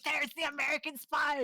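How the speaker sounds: high, excited call-out; acted cry of discovery; mock accusation. Russians expose the cheering spy.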